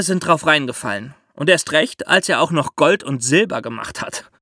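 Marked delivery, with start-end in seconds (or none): none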